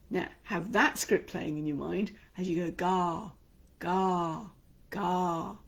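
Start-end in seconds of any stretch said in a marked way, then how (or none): 2.7-5.6 open, free, energised sound. Shown as a demonstration. Coach demonstrating the open confident sound to students. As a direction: let it ring out freely. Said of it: "Could you hear the difference in my voice".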